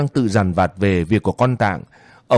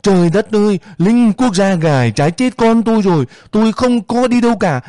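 wailing scream; 9 semitones higher than usual. Acted. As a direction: scream it out to the soldiers, half sobbing. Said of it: "Út Lích đang gào lên".

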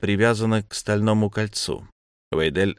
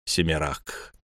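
ordinary delivery, nothing marked

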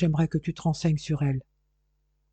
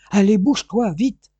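loud, high, pushing command; father urging his groggy son up; urgent shout; 5 semitones higher, 6 dB louder than usual. Acted. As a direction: drive him to his feet. Father forcing drugged son up.

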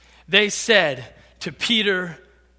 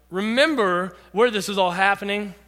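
normal talking voice

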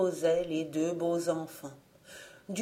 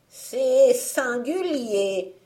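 muttering witch; 4 semitones higher, 5 dB louder, much slower than usual. Acted. Witch puzzled at Jean's thin finger, musing witch voice. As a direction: mull it over like a suspicious old hag. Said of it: "murmurait-elle".

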